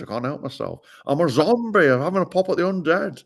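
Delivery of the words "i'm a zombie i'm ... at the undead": higher, louder Partridge bluster; hammed-up Alan Partridge voice, higher and louder; Alan Partridge impression. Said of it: "I just turn into Alan Partridge".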